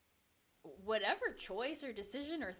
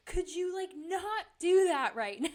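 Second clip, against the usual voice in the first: snarky; 7 semitones higher, 10 dB louder than usual. Retold or imitated. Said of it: "super snarky comment".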